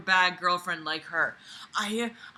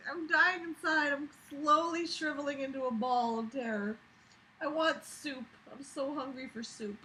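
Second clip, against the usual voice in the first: mock despair; 5 semitones higher, 10 dB quieter than usual. Real, not acted.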